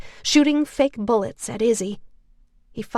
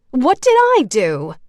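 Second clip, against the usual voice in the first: higher, louder whiny protest; whining; 4 semitones higher, 8 dB louder than usual. A narrator's reading. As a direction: complain like it's unfair.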